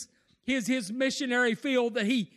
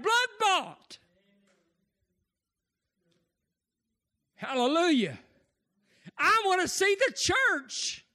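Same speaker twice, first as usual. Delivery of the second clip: fervent preaching; 5 semitones higher than usual. Acted. Preaching at full force.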